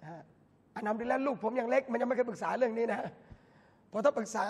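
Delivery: ordinary talk, nothing marked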